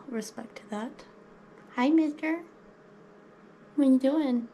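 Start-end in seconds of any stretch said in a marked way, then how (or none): 1.7-4.5 high-pitched hello to a pet; playful pet talk. Real, not acted. Talking to the pet snake.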